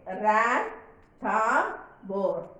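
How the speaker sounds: "Ran, tham, bor" in three separate beats; syllable by syllable. Shown as a demonstration. Teacher dividing a word for the class, syllable by syllable. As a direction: break it as Ran, tham, bor, pausing after each. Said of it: "can be divided into how many syllables".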